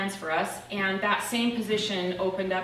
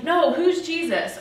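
insistent friend; 7 semitones higher, 5 dB louder, slower than usual. Retold or imitated. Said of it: "He's like".

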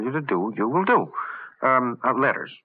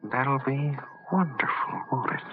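gloating whisper; 6 dB quieter than usual. Acted. Villain dreaming of world control, whispered gloat. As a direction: breathe it like a secret delight.

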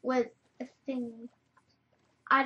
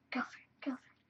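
whisper; 8 dB quieter, faster than usual. Real, not acted.

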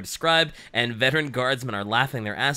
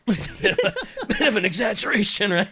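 laughing; 7 semitones higher, 5 dB louder, faster than usual. Real, not acted. Laughing at own exaggeration.

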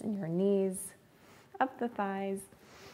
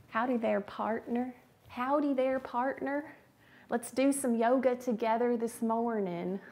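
playful, slightly raised Texas twang; 4 semitones higher than usual. Pretend. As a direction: put on a folksy Texas twang. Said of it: "Make Me Say Something in a Texas Twang".

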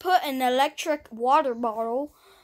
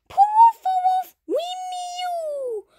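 very high whooping squeals; playful whoops, shooting up in pitch; cheering; 14 semitones higher, 3 dB louder, slower than usual. Pretend. Birds cheering for the new egg, high cheering.

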